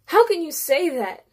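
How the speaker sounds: loud, high shout of anguish. A narrator's reading. Narrator shouting at the mother, loud and high. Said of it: "I shout".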